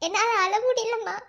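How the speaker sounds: usual speaking voice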